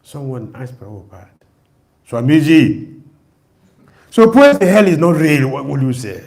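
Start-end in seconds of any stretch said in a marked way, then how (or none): 2.1-6.2 louder, pushing the question; challenging question. Retold or imitated. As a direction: put the challenge to the swami.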